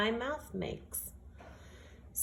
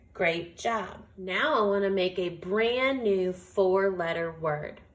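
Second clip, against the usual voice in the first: normal talking voice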